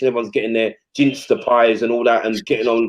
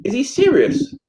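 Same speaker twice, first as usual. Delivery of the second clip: incredulous; 14 semitones higher than usual. Retold or imitated. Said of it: "I knew the assistant".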